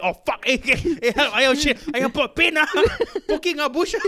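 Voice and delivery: high, loud yelping alien in a panic. Acted. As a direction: cry out like a hapless alien stuck in a bush. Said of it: "that's my go-to alien voice".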